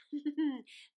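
short mischievous laugh; laughing about tickling; robber girl's laugh. Acted.

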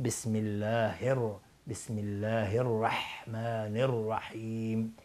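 light h in «الله», strong ḥ in «الرحمن»; correct recitation. Shown as a demonstration. Teacher sums up the lesson, slow correct recitation.

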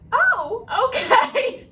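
laughing it out, high and loud. Retold or imitated. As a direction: burst out laughing at the silly surprise. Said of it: "And I just laughed".

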